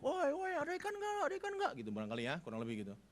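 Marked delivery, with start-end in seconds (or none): 0.0-1.7 higher calling voice across the water. Retold or imitated. Jesus on the shore calls out to the fishermen, pitched up. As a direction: hail the boat from the shore, pitched up. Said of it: "Lalu dia teriak".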